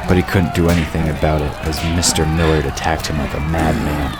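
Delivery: ordinary delivery, nothing marked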